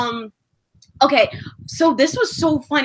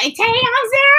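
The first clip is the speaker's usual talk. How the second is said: excited fangirl; 11 semitones higher, 4 dB louder than usual. Retold or imitated. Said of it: "I'm just like".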